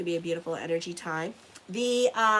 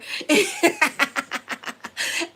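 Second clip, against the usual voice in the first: big burst of real laughter; loud laugh; 9 semitones higher, 8 dB louder than usual. Real, not acted. Laughing while telling the shabbat liquor story, loud real laugh. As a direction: laugh hard at the memory.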